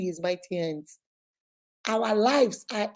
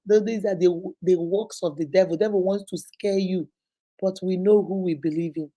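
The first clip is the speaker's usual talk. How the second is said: ordinary delivery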